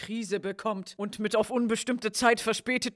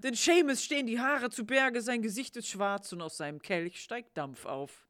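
ordinary talk

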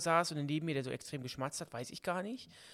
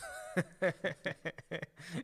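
real laughter; 4 dB quieter than usual. Real, not acted. Laughs at the Drachenlord joke.

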